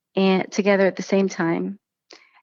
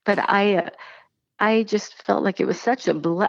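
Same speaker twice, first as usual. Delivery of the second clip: normal talking voice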